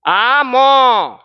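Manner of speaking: "आमो" stretched slowly with an added final o; teacher showing the wrong vowel ending; drawn-out wrong reading. Shown as a demonstration. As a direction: hold the wrong "आमो" long and loud. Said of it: "ऐसे गलती करते हैं हम लोग".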